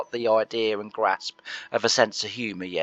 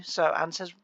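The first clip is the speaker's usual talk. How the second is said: normal talking voice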